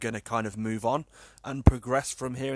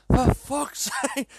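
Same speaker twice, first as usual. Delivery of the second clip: high, loud, slow laughing curse; mock fury, breaking into a laugh; exasperated swearing; 11 semitones higher, 4 dB louder, slower than usual. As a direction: lose it with the gadget, half laughing. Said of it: "I was losing my temper".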